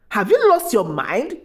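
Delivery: usual speaking voice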